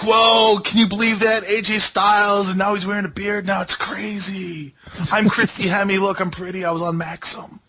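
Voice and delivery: mock Christy Hemme. Mocking Christy Hemme's ringside chatter, in a high airhead voice.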